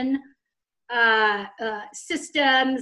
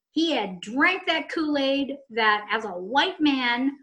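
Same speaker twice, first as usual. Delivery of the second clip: ordinary talk, nothing marked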